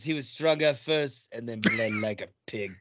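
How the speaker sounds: low, soft, slow deadpan line reading; imitating flat delivery. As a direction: drone it like a bored bad actor. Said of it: "I died laughing with that delivery".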